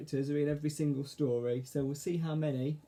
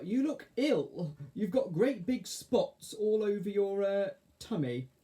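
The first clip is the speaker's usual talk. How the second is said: higher, slow, drawn-out caterpillar voice; Arthur; 6 semitones higher, slower than usual. A narrator's reading. Caterpillar breaks it to fairy slowly, in character. Arthur tells Mavis she looks ill.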